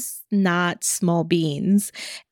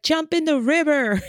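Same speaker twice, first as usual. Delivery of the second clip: mock retort; 7 semitones higher than usual. Retorting while walking away.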